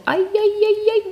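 piercing high wailing cries; shrill screams. Retold or imitated. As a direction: wail it out like a shrill chant. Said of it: "mit so gellenden Schreien".

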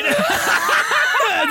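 shrieking laugh; helpless squealing laugh; high burst of laughter. Real, not acted. Guest laughs on air.

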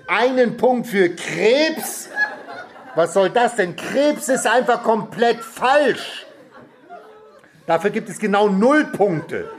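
high-pitched, drawn-out outrage; indignant. A narrator's reading. Middle brother disputes the point given to the boy, indignant. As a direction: protest high, stretching the key words. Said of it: "rief mein mittlerer Bruder entrüstet".

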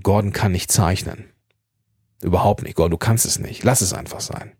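lightly voiced art teacher, low and dismissive, trailing off; a touch of a teacher writing the boy off. Art teacher telling young Gordon to give up drawing, lightly voiced and dismissive. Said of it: "der hat gesagt".

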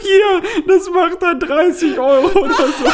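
high, tearful laughter through words; quoting himself while crying with laughter; laughing while quoting. Retold or imitated. Self quoted, laughing.

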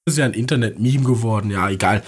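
tired, failing voice; voice giving out. Real, not acted. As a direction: voice going, barely holding on. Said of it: "Meine Stimme versagt immer weiter". (versagende Stimme)

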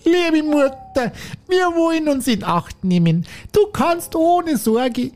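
put-on high, slow child voices; squeaky kid goats promising; high slow childish voice; little goats.

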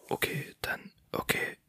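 soft, voiceless whisper; hushed questioning; playing-along whisper. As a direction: whisper back to match the caller. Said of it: "dann flüster ich jetzt auch".

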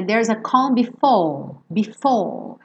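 BEFORE sem enrolar o R, vogal final alongada; R britânico. Shown as a demonstration. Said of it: "soam um pouco como o R britânico". (BEFORE com R mudo, à britânica)

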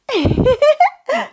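very high, loud giggle; high-pitched burst of tittering; tiny fairy giggle. Acted. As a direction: giggle like a mischievous little creature.

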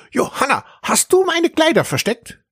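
high, accusing shout; a high, angry boy's accusation. A narrator's reading. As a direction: demand it angrily through the bathroom door. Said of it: "rief er mit böser Stimme".

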